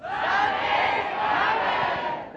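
high, slow shouted reply; crowd yell back. Retold or imitated. Fifteen hundred people yelling back at the pastor, a mock shout. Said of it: "they yelled back".

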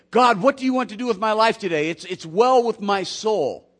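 devoted Mary. Acted. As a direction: offer it up like a willing servant. Mary's devoted words to God.

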